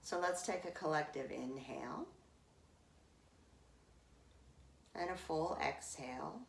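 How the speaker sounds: pace the class through each sip of air; guided breath cues. Acted. Yoga teacher cueing the class through Viloma breath, calm guiding voice.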